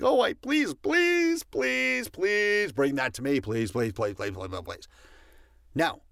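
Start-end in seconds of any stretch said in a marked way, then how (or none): 0.0-4.9 pleading mockery of a needy asker; mock begging. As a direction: beg pitifully and ham it up. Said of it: "You weren't like".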